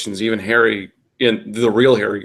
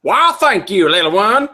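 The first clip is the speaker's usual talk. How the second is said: high, loud, hammed-up southern drawl; southern twang; 9 semitones higher, 7 dB louder than usual. A narrator's reading. Reverend thanking a little boy, broad southern twang. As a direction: lay the southern twang on thick. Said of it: "His voice had a distinctive southern".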